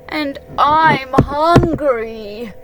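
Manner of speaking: very slow, loud drawn-out groan. A narrator's reading. Pooh groaning while waiting for Owl, slow and loud. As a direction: stretch each word into a hungry groan. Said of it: "groaned Pooh".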